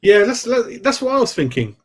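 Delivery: bright burst of agreement to the chat; excited agreement. Real, not acted. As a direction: jump in keen, like you called it first. Excited reply to chat comment.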